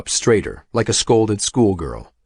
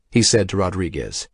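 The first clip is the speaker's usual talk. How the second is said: usual speaking voice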